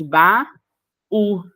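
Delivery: "baú" split into ba, then u after a pause; slow ba, clear break, separate u; syllable by syllable. Shown as a demonstration. Teacher separates baú for students, syllable by syllable. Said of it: "a separação da palavra baú".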